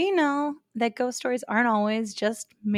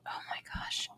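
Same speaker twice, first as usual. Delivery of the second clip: whispering; 14 dB quieter than usual. Real, not acted. Reacting to the ghost story.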